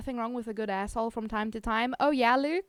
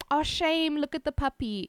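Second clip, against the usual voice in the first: higher-pitched cooing; overwhelmed by cuteness; 5 semitones higher than usual. Acted. Streamer acting out seeing a cute puppy, cooing. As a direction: gush over an adorable puppy. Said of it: "like a overwhelming cuteness".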